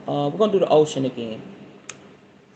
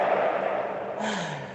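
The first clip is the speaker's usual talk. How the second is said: long sigh; 7 dB quieter than usual. Real, not acted. Settling into meditation.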